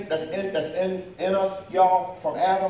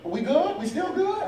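high, timid "we good" climbing even higher; a friend unsure of the friendship, voice pitched way up; anxious check-in; 9 semitones higher than usual. Retold or imitated. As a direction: ask nervously if everything is fine. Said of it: "they'll be like".

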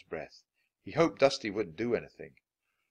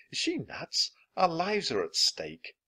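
incredulous; 8 semitones higher than usual. Acted. Jack's panicked thought.

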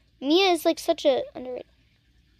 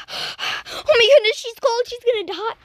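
mock panicked fuss; 8 semitones higher than usual. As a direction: squeal like a frantic worrier. Mimicking a worried character.

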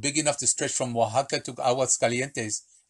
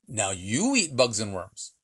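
slow command; slower than usual. Acted. Old man turns gun on Villa.

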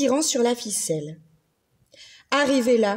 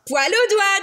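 put-on higher, louder teasing girl; cheeky little girl; 6 semitones higher, 4 dB louder than usual. Lucille answers the monster's threat, high and cheeky. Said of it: "dit Lucille".

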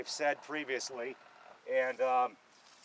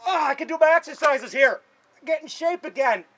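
excited cheer; 10 semitones higher, 8 dB louder than usual. Real, not acted. Excited about the exercise bars.

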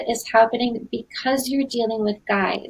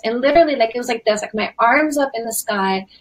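ordinary talk

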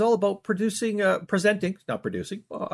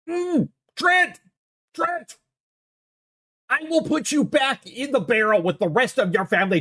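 high, angry shouting of a name; threatening the co-host in a shrill rage; furious yell; 7 semitones higher than usual. Acted. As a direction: bellow at him in frustration. Yelling at Trent again.

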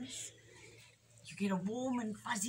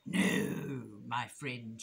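very low, drawn-out weasel voice; creepy storybook villain; menacing hiss; 8 semitones lower, much slower than usual. A narrator's reading. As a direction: stretch the no with sly menace. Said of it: "she hissed".

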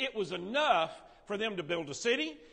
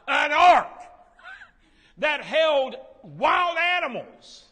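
loud, high, slowed excitement with a laugh; amazed exclaiming; 9 semitones higher, 10 dB louder, slower than usual. Real, not acted. Teacher marvelling to the class at the ark, loud and excited.

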